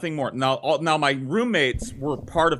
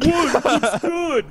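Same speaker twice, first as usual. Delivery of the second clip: defensive roommate; 9 semitones higher, 4 dB louder, slower than usual. Retold or imitated. Roommate defends his tiny shirt.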